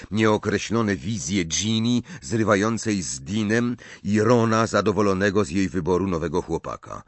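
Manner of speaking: plain reading voice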